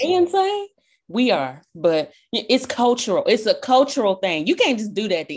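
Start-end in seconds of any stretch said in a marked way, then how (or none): 0.0-0.7 high, disbelieving "they insane?"; imitating puzzled onlookers; incredulous mock quote. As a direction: toss it off like they think we're crazy. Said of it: "they were like".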